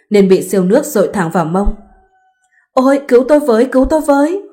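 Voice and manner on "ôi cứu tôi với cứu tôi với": screaming for help. A narrator's reading. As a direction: shriek out for help in terror. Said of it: "la hét ầm ĩ". (la hét cầu cứu hoảng loạn, giọng cao)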